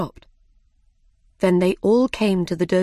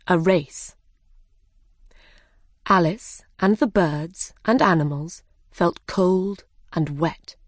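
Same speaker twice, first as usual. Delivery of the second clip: plain reading voice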